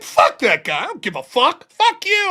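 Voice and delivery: yelling back at a guy at work; retold yelling. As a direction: yell it at the customer. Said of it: "I was yelling at him".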